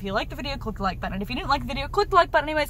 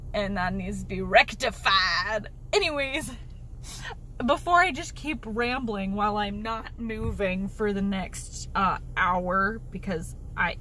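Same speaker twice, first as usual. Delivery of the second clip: slipping into a lower, slow Southern drawl; 4 semitones lower than usual. Real, not acted. Early-morning rant in the car, a Southern drawl creeping in. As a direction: drift into a lazy Southern accent without meaning to. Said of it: "something real southern".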